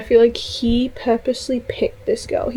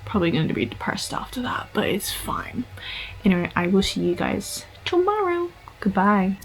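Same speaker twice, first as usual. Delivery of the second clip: soft unvoiced whisper to close the video; whispered sign-off; 8 dB quieter than usual. Real, not acted. Whispering goodbye to viewers at night.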